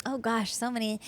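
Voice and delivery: ordinary talk, nothing marked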